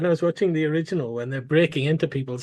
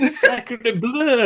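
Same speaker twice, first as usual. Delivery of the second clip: put-on French accent; 8 semitones higher than usual. Mocking French extra.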